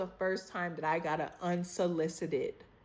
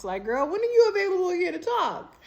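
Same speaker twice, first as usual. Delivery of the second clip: excited; 13 semitones higher, 5 dB louder, faster than usual. Real, not acted. Mock-scheduling with friend.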